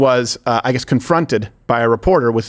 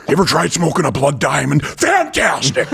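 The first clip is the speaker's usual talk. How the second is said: mock connoisseur. Host playing a snob praising blood diamonds, hammed up. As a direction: savor it like a snob.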